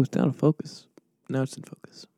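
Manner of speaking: ordinary delivery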